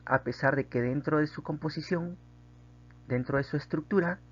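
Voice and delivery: normal talking voice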